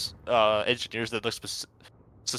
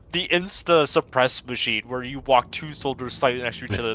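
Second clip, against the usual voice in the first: normal talking voice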